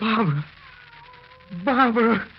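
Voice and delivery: high, loud cry of her name; anguished call. Acted. Husband crying out after his vanished wife, high and loud.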